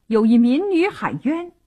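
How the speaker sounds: plain reading voice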